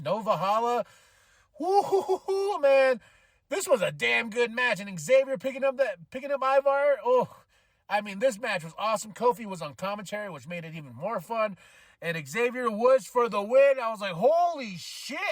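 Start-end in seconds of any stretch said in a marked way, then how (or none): 1.6-3.0 high, drawn-out whooping; thrilled whoop. Real, not acted. Fan reacting to a damn good match, thrilled. 14.1-15.2 high, drawn-out amazement; astonished. Retold or imitated. Fan recalling a surprise win, astonished. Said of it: "I grabbed my head".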